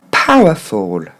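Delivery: slow "Powerful", PAH-wuh-fol; correct English pronunciation. Shown as a demonstration. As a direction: draw out "Powerful" with explosive P and low falling end. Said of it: "maintenant pour le mot en entier".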